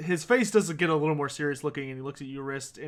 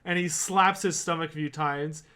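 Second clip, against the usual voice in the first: normal talking voice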